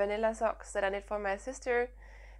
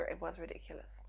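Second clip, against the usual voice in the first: whispering; 3 semitones lower, 12 dB quieter than usual. Real, not acted. Whispered aside about sneezing fit.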